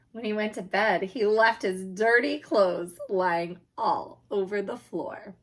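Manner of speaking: plain reading voice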